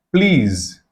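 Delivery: ordinary delivery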